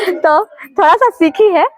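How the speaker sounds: laughing. Real, not acted. Mother laughing about daughter's English.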